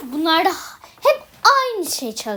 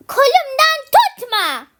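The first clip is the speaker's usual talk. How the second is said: crying, higher and louder; tearful protest; 7 semitones higher, 8 dB louder than usual. Acted. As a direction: sob out the protest. Crying heard on the line. (ağlayarak itiraz)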